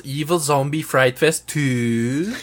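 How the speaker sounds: slow cartoon voice with a drawn-out last word; lightly mocking cartoon-character impression; Dexter. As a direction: stretch out the final word, tune rising. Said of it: "he sounds like Dexter".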